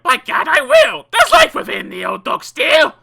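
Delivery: very high, very loud defiant cry; a determined mum rising to the challenge; mother crying out. A narrator's reading. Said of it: "The mother cried".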